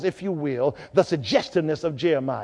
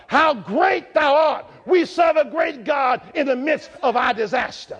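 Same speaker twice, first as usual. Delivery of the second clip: rising sermon cadence, hymn lines shouted; fervent pulpit delivery; preaching at full force; 5 semitones higher than usual. Acted. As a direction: preach it, building to a shout on how great thou art.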